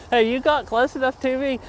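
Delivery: usual speaking voice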